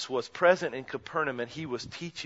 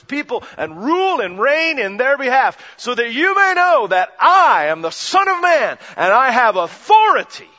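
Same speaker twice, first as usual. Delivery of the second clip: building declaration; 7 semitones higher, 12 dB louder than usual. Acted. Preacher voicing Jesus to the scribes, building to a loud climax.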